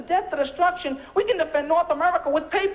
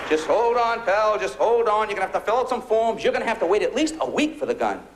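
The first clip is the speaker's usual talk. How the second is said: lower, lightly voiced stern clerk; hint of a firm gun clerk; mock clerk; 7 semitones lower than usual.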